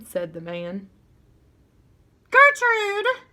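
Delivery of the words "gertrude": high, loud squawking parrot shriek; a parrot shrieking a name; irritating parrot scream. A narrator's reading. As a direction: screech it like an annoying parrot. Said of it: "somebody screamed in a real irritating voice".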